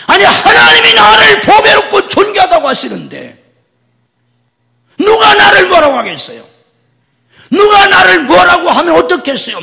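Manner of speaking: high, loud defiant preaching; preaching at full force. Acted. Pastor rallying the congregation with a loud shout. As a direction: challenge anyone to judge you.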